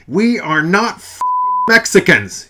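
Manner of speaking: huge slow furious roar. Acted. Enraged god roaring at Mother, furious roar. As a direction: let the whole house shake with it. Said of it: "he roared".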